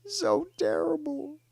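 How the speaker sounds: high mock-frightened squeal. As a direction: ridicule it in a high, put-on voice. Mocking a failed threat.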